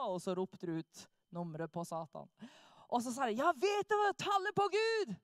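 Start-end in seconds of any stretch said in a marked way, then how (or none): 3.4-5.1 higher, louder call to the crowd; preacher challenging satanists from the stage, loud and high; proclaiming call. Retold or imitated. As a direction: throw the question out over a heckling crowd. Jørn calls out to hecklers.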